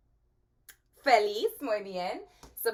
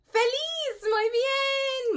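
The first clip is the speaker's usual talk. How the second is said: much higher, louder cheery squeal; hammed-up joyful voice; mock happy; 5 dB louder, much slower than usual. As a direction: beam the word Feliz like a delighted kid. Says happy word happily.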